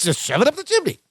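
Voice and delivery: high, insistent answer that falls at the end; Balky. Retold or imitated. Cousin repeats his hiding place to Larry, high imitated insistence. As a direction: imitate the odd cousin, pitched well up. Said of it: "And Balky goes".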